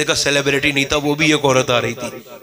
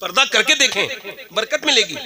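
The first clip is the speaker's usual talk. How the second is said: normal talking voice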